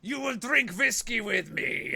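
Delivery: slow, emphatic foreign biker voice; stern biker. Retold or imitated. Biker sentencing rider to drink, slow stern voice. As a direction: pronounce a mock sentence with heavy deliberation. Biker pronouncing mock punishment, accented.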